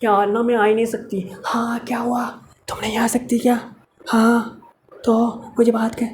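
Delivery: quiet secretive phone voice; speaking softly. Acted. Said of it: "वो धीरे-धीरे बोल रही है".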